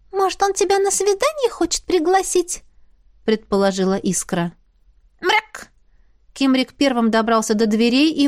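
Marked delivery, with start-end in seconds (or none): 0.1-2.6 high, squeaky little spirit, businesslike. Acted. Spirit talking business with the heroine, high squeaky voice. 5.2-5.7 indignant cat meow. Acted. Cat protests.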